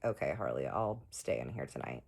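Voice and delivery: resigned. Retold or imitated. Giving in, sad for him.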